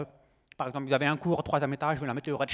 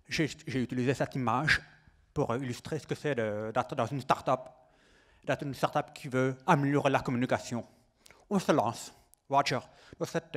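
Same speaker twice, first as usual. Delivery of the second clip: French with an American accent; American accent on every French word. Real, not acted. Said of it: "j'ai un accent américain".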